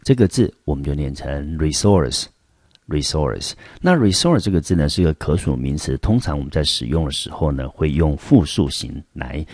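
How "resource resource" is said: resource with the weight on source, said twice; stress on source in resource. Shown as a demonstration. Teacher drills resource twice for the class. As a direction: re short, then land on source. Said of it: "重音节就在第二个音节".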